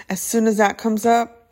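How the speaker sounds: ordinary delivery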